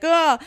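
sudden high, loud retold cry; a quick startled shout; mock yell of alarm. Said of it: "he's like".

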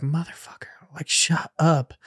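harsh whispered curse at the cats. Retold or imitated. Whispering at cats at four in the morning. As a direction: hiss it so nobody wakes. Hissing at fighting cats at night.

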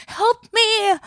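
high-pitched mock-helpless wail; acted plea for help. Retold plea to laughing friend.

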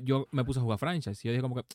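usual speaking voice

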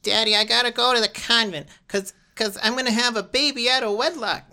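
high, loud bratty daughter voice; hammed-up whiny daughter begging her dad; mock girly daughter. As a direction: squeal it up high like a spoiled girl. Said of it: "She's like".

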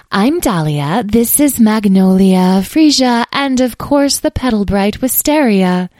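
high, loud, gracious fairy voice; Dahlia. A narrator's reading. Fairy introduces companions to the child, high and grand. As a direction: present each name grandly.

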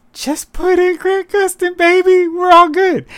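very high, hammed-up sales pitch; hyped mock pitch. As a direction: sell the easy fix in a high, pushy voice. Acting out a fan pitch.

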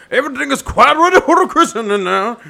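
high, loud, fast put-on clergyman voice; hammed-up Doctor Chasuble. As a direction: burst in as a comic reverend.

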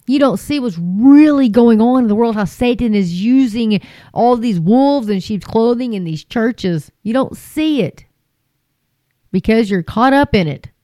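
ordinary delivery

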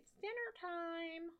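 high, soft singsong call; mom calling out. Retold or imitated. Podcaster relays mom's call to dinner, high singsong imitation. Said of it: "my mom is like".

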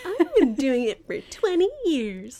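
high, puffed-up proud voice; proud boast. Retold or imitated. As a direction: say it like bragging. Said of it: "I'm like".

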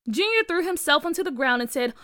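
usual speaking voice

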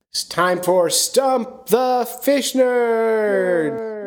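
drawn-out, high game-show title call; big hammy jingle-style intro; segment announcer. Pretend. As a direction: stretch each word, climbing into a long held finish.

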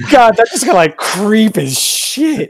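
high laugh that trails into speech; laugh, then slow down. Real, not acted. Laughing at the creepy bit.

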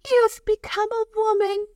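high-pitched, delighted grandma voice; excited grandma. Retold or imitated. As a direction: announce it like a proud, thrilled nana. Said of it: "she's excited".

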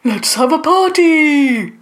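high, loud, drawn-out party cry; slow, whooping shout of celebration; trumpeted. A narrator's reading. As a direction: trumpet it out to the whole crowd. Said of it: "one of the bants trumpeted".

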